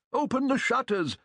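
put-on high eager old man; old man crying out. A narrator's reading. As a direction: call out excitedly for light. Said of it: "cries the old man".

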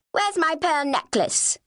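high, drawn-out accusing question; haughty sister's demand. Acted. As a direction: demand it as an indignant older sister. Sister barges in demanding necklace.

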